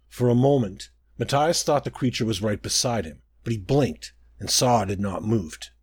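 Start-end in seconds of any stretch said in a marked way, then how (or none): none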